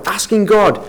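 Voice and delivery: normal talking voice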